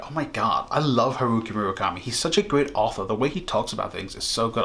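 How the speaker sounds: put-on gushing Valley Girl, rattled off fast; Valley Girl accent. Fan gushing about a favourite author, in a Valley Girl accent. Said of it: "I'm doing a Valley Girl accent".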